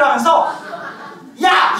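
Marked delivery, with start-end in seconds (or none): 1.4-1.8 sharp, loud shout, pitched up; angry yell. Retold or imitated. Old lady shouts at the monk in anger. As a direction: bark it at him like a furious old lady. Grandma yells at the head monk.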